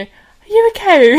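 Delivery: high, loud, slow call from another room; mock shout. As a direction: shout it through the wall, worried. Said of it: "you just shouted from your room".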